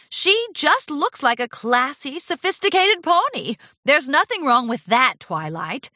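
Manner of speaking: mildly snooty insistence; haughty defense. A narrator's reading. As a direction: stand up for her as if beyond question. Rarity argues back, nose up.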